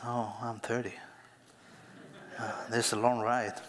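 ordinary talk

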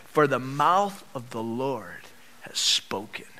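slow, weighty, trailing into breathiness; slow right down and let the last words hush; solemn close. Acted. Preacher closing the prophet's passage, solemn and slow.

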